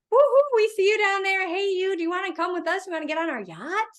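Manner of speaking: high, cheery party hail; yacht crowd calling out. Retold or imitated. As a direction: whoop down to the man like partygoers.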